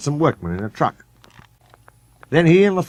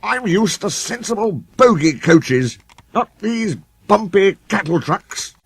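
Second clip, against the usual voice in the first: haughty complaint; 9 semitones higher than usual. A narrator's reading. Sir Handel complaining.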